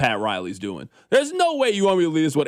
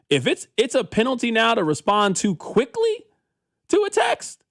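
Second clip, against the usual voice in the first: incredulous; 8 semitones higher than usual. Real, not acted. Disbelief at dating topic.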